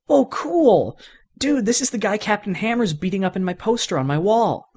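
put-on young fan thrilled to get a toy; excited kid. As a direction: gush like a kid who just got a prize toy.

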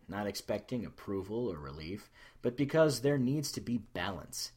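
plain reading voice

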